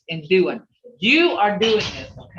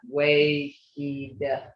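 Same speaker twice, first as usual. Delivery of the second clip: wegida drawn out syllable by syllable, very slow; sounded out slowly; 4 semitones lower, 3 dB quieter, much slower than usual. Shown as a demonstration. Teacher sounding out wegida for the class, very slowly. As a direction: stretch out wegida so students hear each part. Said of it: "So if you sound it out".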